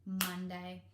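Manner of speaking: Monday said as one quick blended word; squished together. Shown as a demonstration. Teacher blending the syllables back for parents. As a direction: blend the two beats into one. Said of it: "then you squish them together".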